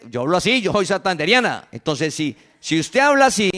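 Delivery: normal talking voice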